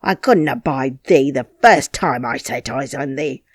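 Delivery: angry harangue. Acted. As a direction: rant at her, building scorn. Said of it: "he harangued".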